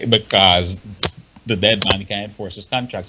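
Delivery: ordinary delivery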